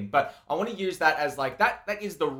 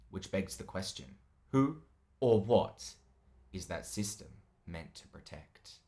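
dramatic reading; 5 semitones lower than usual. Acted. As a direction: read it like a true crime intro. Reads podcast description dramatically.

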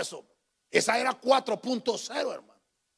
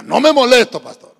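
much louder repeat-after-me, then dropping off; louder call-and-response; 4 semitones higher, 14 dB louder than usual. Shown as a demonstration. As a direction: say it again properly, with force. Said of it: "No, diga".

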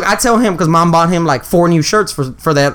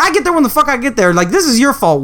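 retold angry yell; 7 semitones higher than usual. Angry phone call with brother.

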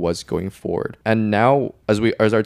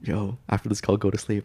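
quiet voice; 5 dB quieter than usual. Retold or imitated. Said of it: "I have to whisper".

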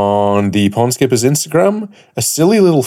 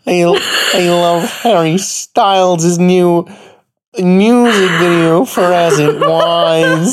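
slow, higher mock American girl voice; Sarah impression; 5 semitones higher, slower than usual. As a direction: drawl it out as a higher girlish voice. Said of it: "Do your best impression of me".

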